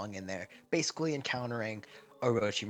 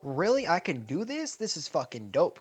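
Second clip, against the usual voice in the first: higher, louder amazed burst; light up at the discovery; excited; 7 semitones higher, 5 dB louder than usual. Retold or imitated. Naruto finding his new ability, loud and excited.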